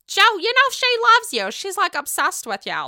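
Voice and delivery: imitating Margaret. Margaret defending Melissa to Joe, mock voice. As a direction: play the bold friend butting in. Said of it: "you got badass bitch Margaret".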